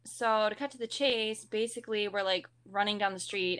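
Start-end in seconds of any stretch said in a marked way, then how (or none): none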